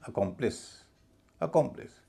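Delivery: अकम्प्लिश as "ə-KOM-plis"; light अ, then heavy rounded KOM in अकम्प्लिश; correct stressed pronunciation. Shown as a demonstration. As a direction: stress कॉम in अकम्प्लिश, first vowel reduced. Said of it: "ए ना होके अ हो जाएगा".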